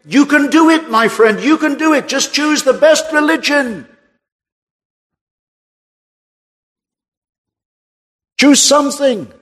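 voice of other religions. Retold or imitated. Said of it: "All of those religions are saying".

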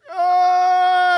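high, loud imitated infant wail; baby wail. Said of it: "they start crying".

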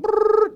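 a very high, loud trilled brrr; phone ring trill. Retold or imitated. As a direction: imitate a ringing phone. Imitating the phone ringing.

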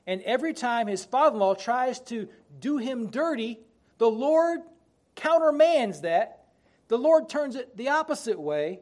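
rising fiery preacher cry; preaching at full force. Acted. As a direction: build the sermon to a peak.

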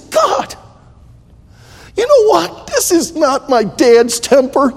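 pleading high to God, alone at night; crying out to God. Retold or imitated. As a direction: cry out in anguish, pitched high. His prayer quoted, crying out.

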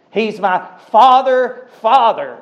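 tender cry. Acted. Heart cry to heavenly daddy.

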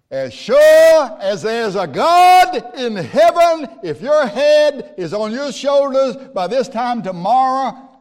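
high, loud mock-shrill threat from Jezebel; Jezebel's threat. Preacher voicing Jezebel's threat to Elijah, high hammed-up menace. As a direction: ham up the wicked queen's threat, high and loud.

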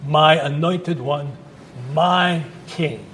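my said slowly and weighted each time; slow, pointed my before anointed and king; stress on my. Shown as a demonstration. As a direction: lean on each my. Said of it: "Notice the my's in there are very important".

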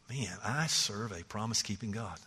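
soft, low hushed marvel; quiet awe. Retold or imitated. Believer marveling at God's promises, softly. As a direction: breathe it out in wonder.

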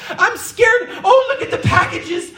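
high, laughing mock panic; hammed-up frightened whine over lotions; mock fear. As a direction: ham up a paranoid shut-in. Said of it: "balled up in the fetal position".

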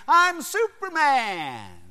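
high, drawn-out triumphant cry; exultant boy. Retold or imitated. Boy leaping off the garage roof, exultant cry. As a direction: call it out like a kid in flight.

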